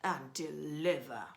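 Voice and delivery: loud, booming highway rat; booming bandit rat; thundering rat. Acted. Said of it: "who thundered".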